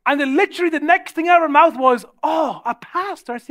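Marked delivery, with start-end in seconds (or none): 2.2-3.3 high, drawn-out retold reaction; taken aback. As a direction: imitate a woman thrown by the news. Woman reacts to hearing his job.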